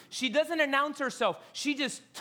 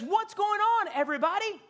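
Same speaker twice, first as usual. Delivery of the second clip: calling out; 10 semitones higher, 4 dB louder than usual. Acted. Blind man calls out.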